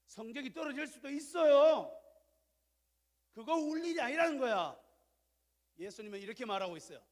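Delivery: loud, high fervent sermon delivery; full-force preaching. Acted. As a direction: preach it out to the congregation. (목소리를 높여 열정적으로 설교)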